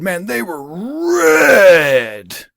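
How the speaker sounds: high, loud French R drawn way out on "red"; exaggerated French accent. Imitating the Senegalese coworker's red pants story, exaggerated French accent. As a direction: stretch "red" into a long French trill. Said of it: "in his French accent".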